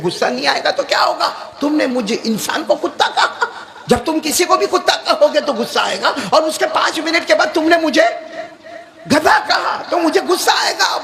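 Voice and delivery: high, fast indignant outburst; angry retort. Retold or imitated. The offended hakim answering the Maulana, high indignant outburst. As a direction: protest hotly at the insult.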